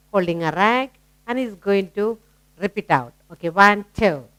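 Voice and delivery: ordinary talk, nothing marked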